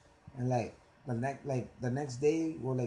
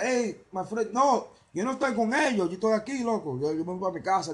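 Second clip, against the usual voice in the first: higher, louder Spanish plea; imagined talk to Dominican cops, raised; 8 semitones higher, 6 dB louder than usual. Retold or imitated. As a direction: plead your way out in Spanish, loud. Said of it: "then I'd be like".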